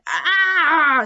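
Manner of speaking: loud high gasp of alarm; startled cry. Acted. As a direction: yelp in shock. Professor startled by noise.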